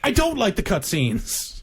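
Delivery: high, slow deadpan confession; blunt put-on voice. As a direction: admit it bluntly, drawn out. Tecmo skit blunt answer.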